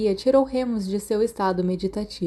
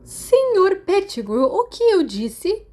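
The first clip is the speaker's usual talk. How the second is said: higher, slow, louder scolding; angry nurse; 11 semitones higher, 5 dB louder, slower than usual. A narrator's reading. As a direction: drag out each word in anger. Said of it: "parecendo muito zangada". (bronca lenta e aguda)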